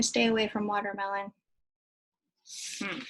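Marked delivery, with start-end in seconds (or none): none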